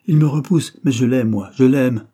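loud, passionate insistence; cry of love. A narrator's reading. Girl declaring love for another to her suitor, passionate cry. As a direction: cry it out, punching each declaration. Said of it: "ce cri d'amour lancé pour un autre".

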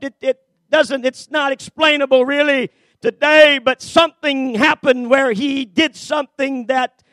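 normal talking voice

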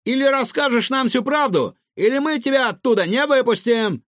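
high-pitched, insistent threat; threatening. A narrator's reading. Gavroche threatens the trapped cook, menacing. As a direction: press hard with an ultimatum. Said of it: "пригрозил повару Гаврош".

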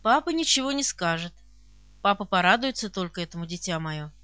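low, gentle fatherly voice; affectionate old count. A narrator's reading. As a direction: reassure the child tenderly, softening at the end. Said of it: "услышала я ласковый голос". (ласковый низкий голос старого графа)

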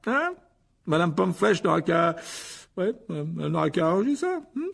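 high, loud, blustering voice; pompous Lockhart, covering his blunder. A narrator's reading. Lockhart excusing his botched spell, high blustering voice.

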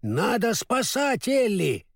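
high, drawn-out call without extra volume; crying out. A narrator's reading. Scarecrow urging the rescue of Ellie, crying out. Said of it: "закричал Страшила".

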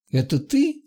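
a touch of disbelief, voice going right up; astonished exhale. A narrator's reading. Ванька recognises Дебби and asks, mildly astonished. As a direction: pitch leaping high on the short question. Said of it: "выдохнул Ванька".

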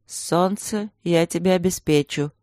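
ordinary delivery, nothing marked

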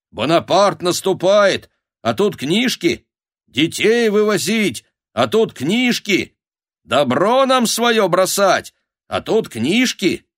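high, drawn-out indignant wail; clamouring crowd. A narrator's reading. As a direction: complain like villagers in uproar. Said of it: "Загалдели опять все разом". (возмущённый бабий галдёж)

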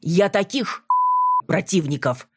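a touch of hearty scorn, pushed up; heartfelt outburst. A narrator's reading. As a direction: blurt it out with feeling. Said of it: "от души выдал вампир".

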